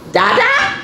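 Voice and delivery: very high, loud barked call; high, excited dog voice; dog parent. A narrator's reading. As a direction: call it sharply upward as a dog. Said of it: "says the dog".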